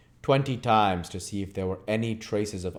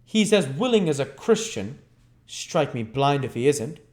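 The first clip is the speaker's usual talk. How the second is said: higher, rough pleased growl; grim approval; 6 semitones higher, 4 dB louder than usual. Acted. Sikes praises his dog grimly. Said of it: "grim and ferocious approval".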